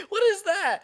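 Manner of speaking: high, loud laughing question; cracking up, pitch shooting upward; laughing disbelief. Real, not acted. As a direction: burst out laughing as you ask. Cracks up at the text.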